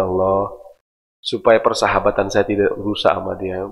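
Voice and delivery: hushed, tender supplication; gentle prayer. Acted. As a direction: pray it almost under the breath.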